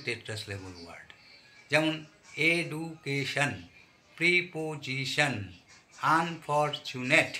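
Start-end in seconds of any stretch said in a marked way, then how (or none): 2.5-7.3 এডুকেশন, প্রিপোজিশন, আনফরচুনেট slowly split into four syllables each; teacher slowly separating each syllable of এডুকেশন, প্রিপোজিশন, আনফরচুনেট. Shown as a demonstration. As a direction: sound out এ-ডু-কে-শন, প্রি-পো-জি-শন, আন-ফর-চু-নেট. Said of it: "চারটা করে syllable আছে".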